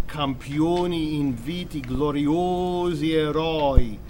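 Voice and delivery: slow "campioni inviti gloriosi eroi" with the O vowels clearly open or closed; sung-style demo of Italian vowels. Shown as a demonstration. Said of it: "make more distinction between open and closed vowels".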